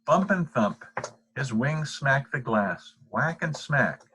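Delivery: playful read of whack and smack; sound words stressed. A narrator's reading. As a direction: let the noise words bounce. Moth noises read out.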